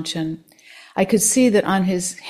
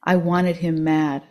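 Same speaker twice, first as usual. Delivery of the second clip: ordinary delivery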